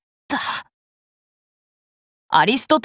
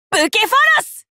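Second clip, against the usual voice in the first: 宝具の叫び; 8 semitones higher, 3 dB louder, faster than usual. Acted. Noble phantasm chant climax.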